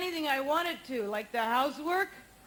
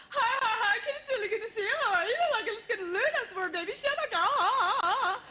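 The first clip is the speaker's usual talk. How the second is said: imitating giddy gal friend; 6 semitones higher, faster than usual. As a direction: gush like an overexcited friend at a bar. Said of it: "she texts like".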